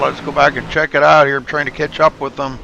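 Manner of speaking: loud, high excited call. Real, not acted. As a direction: shout the wreck call out. Announcer calls a wreck.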